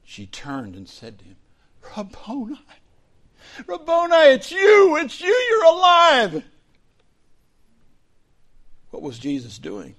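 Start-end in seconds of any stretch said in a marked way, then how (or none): 2.0-6.4 a much higher, louder cry of recognition; joyful shout. Acted. Mary shouting with joy on recognizing Jesus.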